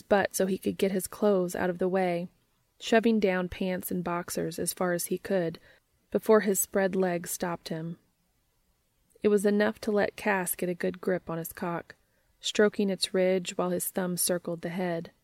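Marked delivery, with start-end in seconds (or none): none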